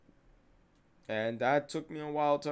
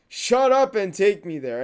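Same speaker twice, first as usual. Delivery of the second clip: loud, high burst of impatience; angry demand; 7 semitones higher, 11 dB louder than usual. Acted. Telling a reluctant friend to drive, angry and loud. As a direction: cut him off with a forceful order. Snapping at friend in retold quarrel.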